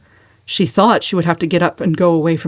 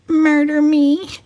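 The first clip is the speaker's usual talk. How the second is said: much higher, slow and grave; solemn; 10 semitones higher, slower than usual. A narrator's reading. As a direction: pronounce it like doom. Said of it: "said cousin Georgiana solemnly".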